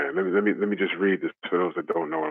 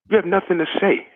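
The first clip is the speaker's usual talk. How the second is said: heated rant; 9 semitones higher than usual. Acted. Ranting at the boxer.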